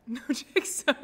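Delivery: high, loud apology through laughter; laughing. Real, not acted. Streamer apologising for no Czech, laughing. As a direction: break into giggles on the apology. Laughing at her own failed attempt.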